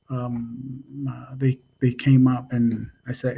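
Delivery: normal talking voice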